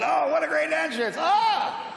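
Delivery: hammed-up delight at his own entrance; mock excited gush. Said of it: "I said".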